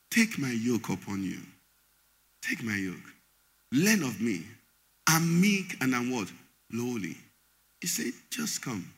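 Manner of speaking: low, soft, gentle invitation; tender Jesus. Acted. Jesus quoted softly to the troubled church. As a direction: coax the weary in close.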